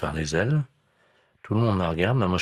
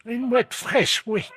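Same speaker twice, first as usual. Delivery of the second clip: put-on old lady voice; much slower than usual. Prankster voices the seagull-eating lady.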